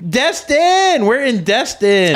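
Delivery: high, excited cry of the town name; excited shout. Pretend. Wife's imagined last words, an excited yell. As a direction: call out the name like a thrilled tourist. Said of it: "Her last words are gonna go".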